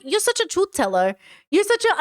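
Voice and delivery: light mock Boston accent, pitch jumping up on the repeat; slight Boston accent. Commentator mimics lawyer praising the witness, slight Boston accent. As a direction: put on a hint of Boston. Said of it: "giving him a little, like, Boston accent".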